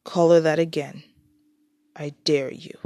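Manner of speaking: low, restrained challenge; glaring threat. A narrator's reading. Peter confronting Abby over an insult, low threat. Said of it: "Peter glared at Abby".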